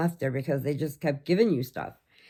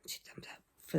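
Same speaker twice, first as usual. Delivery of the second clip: whispered praise; breathy secret whisper of approval; 16 dB quieter, faster than usual. Real, not acted. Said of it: "unvoiced whisper".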